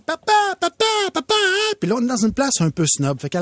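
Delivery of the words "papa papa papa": put-on high kid voice calling papa over and over; tug-and-call like a little girl; child calling out. Dad imitates daughter calling him in a café. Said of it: "elle crie".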